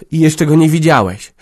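high and loud excited call; loud, high, happy announcement. A narrator's reading. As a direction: call out to him, eager to show the baby. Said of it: "wołała".

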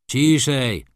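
judge calling out. A narrator's reading. Judge hushing quarrelling women in court, calling out. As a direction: call it out with authority. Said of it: "zawołał sędzia".

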